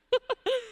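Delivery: high quick giggle; short burst of high-pitched laughter. Acted. Performer delighting in her skin tone, giggling.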